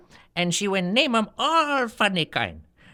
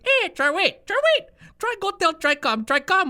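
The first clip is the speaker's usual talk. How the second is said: high-pitched, insistent calling; comic auntie shouting instructions; hollered orders; 10 semitones higher than usual. Acted. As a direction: holler to the kid to fetch another.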